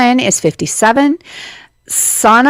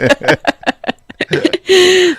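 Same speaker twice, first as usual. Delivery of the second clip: high burst of laughter; laughing; 9 semitones higher than usual. Real, not acted. Laughing after meow.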